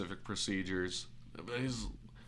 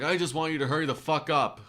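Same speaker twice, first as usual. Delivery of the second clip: raised, higher mock-angry voice; impatient demand; 7 semitones higher, 6 dB louder than usual. Impatient customer rushing the mechanic, imitated anger. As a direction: imitate a customer losing patience.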